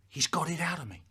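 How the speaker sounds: ordinary talk, nothing marked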